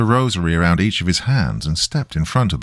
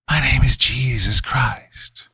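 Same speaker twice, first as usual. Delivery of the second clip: high, slow breathy rasp; high voiceless demon taunt; mocking demon; 6 semitones higher, slower than usual. A narrator's reading. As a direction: draw out a false name in a hissing whisper. Demon mocks with false name.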